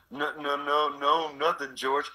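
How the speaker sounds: put-on a different, childlike Lennie from Of Mice and Men. Lennie denying to George he has a mouse, in a childlike voice. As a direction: play the simple, guilty giant denying it. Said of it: "if I was doing Of Mice and Men".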